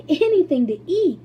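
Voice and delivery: high, loud, delighted; laughing cry. Acted. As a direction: burst out with glee. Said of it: "cried Charlie, laughing".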